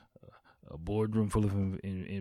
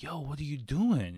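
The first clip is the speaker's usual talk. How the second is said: raised-pitch, mildly alarmed objection; retold remonstrance; 7 semitones higher than usual. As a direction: call out a friend's behaviour. Retold alarm at friend's yelling.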